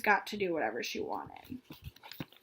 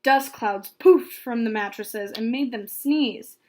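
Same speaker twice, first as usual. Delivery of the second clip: ordinary delivery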